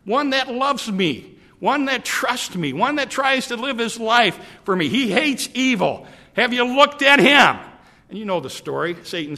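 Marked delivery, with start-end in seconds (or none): 0.0-7.6 high, loud proud boasting; God showing off his servant with pride; proud bragging. Acted. God boasting to Satan about Job, proudly loud. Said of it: "God bragged on and said".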